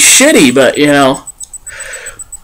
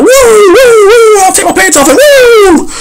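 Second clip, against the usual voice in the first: much higher, louder whooping wrestler yell; Ric Flair woo; 4 dB louder than usual. Retold or imitated. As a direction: let out a wild strutting wrestler woo. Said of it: "and he's like".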